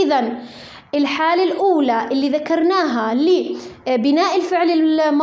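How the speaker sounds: ordinary talk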